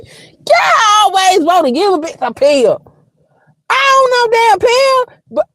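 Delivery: high-pitched comic outburst at the doctor; indignant retort. Retold or imitated. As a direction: explode in shrieky protest. Acted angry retort to the doctor.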